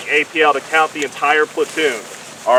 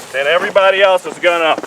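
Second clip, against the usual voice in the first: loud drill instruction; calling from far away; 7 dB louder than usual. Real, not acted. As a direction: call it out across the lane. Calling orders during drill.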